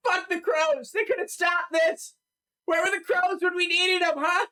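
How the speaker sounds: high, strained rabble-rousing shout; angry townsperson. Acted. Townsperson denounces the crows to the crowd, high angry shout. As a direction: rail like a furious mob member.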